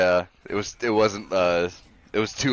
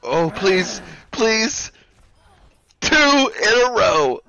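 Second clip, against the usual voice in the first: high, loud pleading shouts; excited cheering; 10 semitones higher, 5 dB louder, much slower than usual. Real, not acted. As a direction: beg and cheer, rising. Second team wipe in game.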